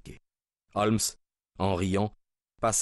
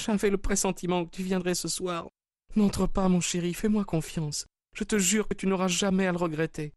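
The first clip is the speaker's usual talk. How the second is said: much higher woman's voice, imploring; pleading wife; 11 semitones higher than usual. A narrator's reading. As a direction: beg him tenderly not to enter. Wife begs husband at door. (épouse suppliante, voix haute)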